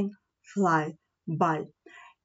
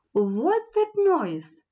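ordinary delivery, nothing marked

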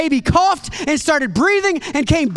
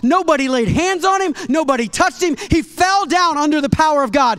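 louder, building preacher's climax; preaching at full force; 4 semitones higher, 4 dB louder than usual. Acted. Preacher telling the healing to the church, rising and louder. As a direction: build it up to the big reveal.

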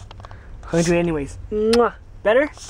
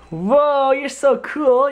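high, delighted whoa; excited praise; 7 semitones higher, 3 dB louder, much slower than usual. Real, not acted. Praising child in sunglasses.

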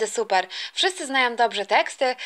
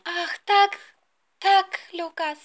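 higher-pitched lovestruck bride; acted young bride; 5 semitones higher, much slower than usual. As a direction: answer the suitor in a high, drawn-out voice. Bride answers suitor in rehearsal.

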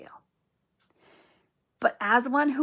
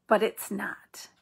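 whisper; slower than usual. Real, not acted. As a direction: lean in and whisper it earnestly. Insisting her choice was real.

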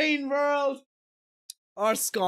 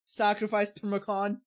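usual speaking voice

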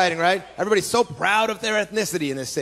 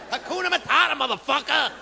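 street guy; 6 semitones higher than usual. Retold or imitated. Truck guys debating songs.

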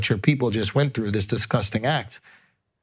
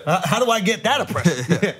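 higher-pitched, through real laughter; laughing joke; 7 semitones higher than usual. Real, not acted. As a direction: crack up while asking. Joking amid shared laughter.

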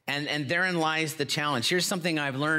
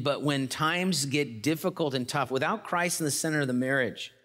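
usual speaking voice

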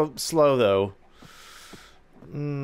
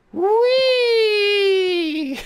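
high, loud gleeful whee; a loud happy ride squeal; excited squeal; 14 semitones higher, 6 dB louder than usual. Real, not acted. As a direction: squeal with delight on the drop. Riding a minecart downhill.

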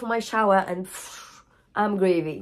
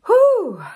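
a sharp high whew of release, loud; letting the crying out in one high, loud breath; shaky exhale; 13 semitones higher, 8 dB louder than usual. Real, not acted. Exhale after admitting she's crying.

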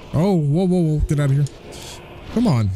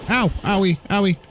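mock pained yelps; 8 semitones higher than usual. Character takes hits.

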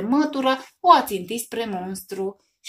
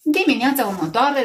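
ordinary delivery, nothing marked

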